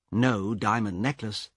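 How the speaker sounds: ordinary delivery, nothing marked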